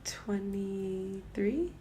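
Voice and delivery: very slow, near-whispered question; hesitant guess. Real, not acted. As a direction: venture a cautious, hushed guess. Guessing the host's age, unsure.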